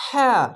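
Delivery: হ্যাঁ said with air pushed out; breathy ha. Shown as a demonstration. Teacher showing students the breathy ha against the plain one. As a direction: let breath escape on হ্যাঁ. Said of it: "বাতাস বের হবে".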